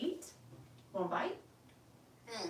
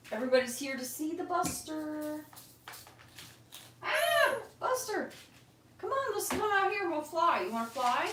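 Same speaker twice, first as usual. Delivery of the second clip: high coaxing pet talk; 11 semitones higher than usual. Real, not acted. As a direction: coax the bird out in a high, sweet voice. Coaxing pet bird to come out.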